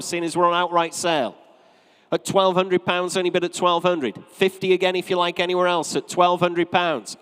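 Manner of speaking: brisk, projected auctioneer calling bids; auctioneer's patter. Real, not acted. Auctioneer to room and online bidders, selling at twelve hundred, projected patter. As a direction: work the room for bids, drawing out the sale.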